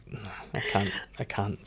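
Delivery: very soft, self-defeated mumble; shrinking away under the breath; embarrassed retreat. Retold or imitated. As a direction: back out sheepishly, barely audible. Voicing own embarrassed retreat from pitching.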